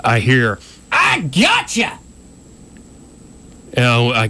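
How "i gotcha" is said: sudden high-pitched gotcha yell; retold yell. Storyteller imitating officer who surprises them on the dark bridge, yelling. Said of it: "just yelling at me".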